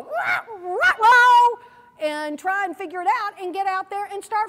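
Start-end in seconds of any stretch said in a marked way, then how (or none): 0.0-1.6 much higher, building to a loud straining cry; effortful rah. Retold or imitated. Someone forced out of their comfort zone, straining loudly. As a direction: push the sound out like flapping hard to fly. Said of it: "you had to go".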